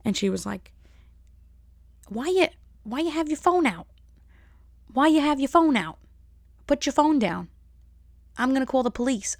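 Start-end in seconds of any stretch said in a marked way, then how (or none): 2.1-9.4 imitated indignant demand, pitched up; imitated confrontation. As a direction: act out her challenging the people following her. Acting out her confronting her followers.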